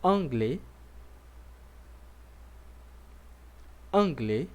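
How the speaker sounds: anglais said twice for the class to repeat; model for repeating. Shown as a demonstration. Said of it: "Vamos repetir comigo".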